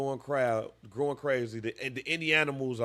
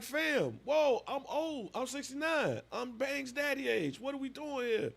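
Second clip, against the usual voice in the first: put-on protesting old man; 9 semitones higher, 3 dB quieter than usual. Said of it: "the old man was like".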